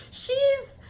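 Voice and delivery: high, hammed-up British accent, stretched and indignant. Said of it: "Wait, what is my British accent?".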